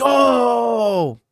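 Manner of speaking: loud, high "Oh!"; mock crowd cheer. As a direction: cheer a brutal knockout. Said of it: "all your friends are like".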